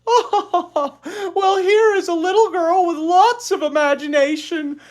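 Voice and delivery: high, loud laughing teacher voice; teacher laughs delightedly at Ramona, high and loud. Acted. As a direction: gush through a laugh. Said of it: "Miss Robbins laughed".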